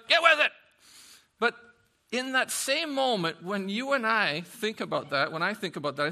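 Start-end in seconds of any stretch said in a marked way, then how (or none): 0.1-0.5 much higher and louder, a sharp yell; exasperated shout. Retold or imitated. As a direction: bark the order at the crowd. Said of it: "You know, like".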